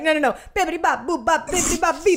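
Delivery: high, loud mock-Italian gibberish; Peter's fake Italian. Imitating Peter ordering pizza, loud gibberish Italian. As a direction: rattle off bouncy nonsense as pretend Italian. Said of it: "he's speaking Italian".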